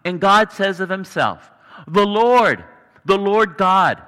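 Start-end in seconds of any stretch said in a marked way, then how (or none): 1.9-4.0 louder, ringing scripture quote; solemn proclamation. Acted. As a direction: proclaim it forcefully as God's own words. God proclaims his name to Moses.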